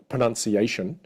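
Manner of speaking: ordinary talk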